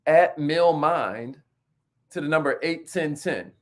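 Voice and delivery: each word slow and separate, spelled out; spelled out slowly. Shown as a demonstration. Host reading the text code out to viewers, slow and deliberate. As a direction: dictate the handle slowly so viewers can write it down. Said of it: "So text message".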